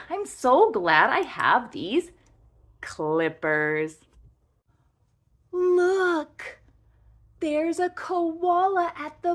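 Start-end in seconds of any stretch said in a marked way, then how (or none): none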